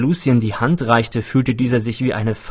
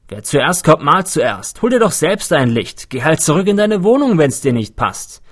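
high, heated comeback; irritated, raised voice telling someone off; quarrelling retort; 5 semitones higher, 5 dB louder than usual. A narrator's reading. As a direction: argue back loudly in a crowd squabble. Overheard quarrel over floodlight.

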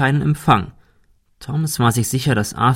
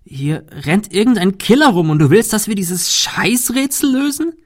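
viel höher und lauter, aufgebracht; verzweifelt wütend; 10 semitones higher, 3 dB louder than usual. A narrator's reading. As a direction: lass die Wut durchbrechen. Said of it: "Shirley klang verzweifelt und latent wütend". (verzweifelt, latent wütend)

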